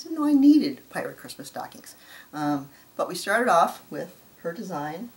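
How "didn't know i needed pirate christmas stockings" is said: high and loud on needed; excited emphasis. Real, not acted. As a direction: burst out with the realization. Restated with emphasis.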